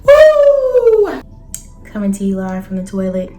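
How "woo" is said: loud high woo; excited whoop; hype woo. Real, not acted. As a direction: pump yourself up. Hyping himself up.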